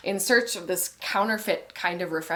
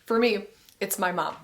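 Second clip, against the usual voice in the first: ordinary talk, nothing marked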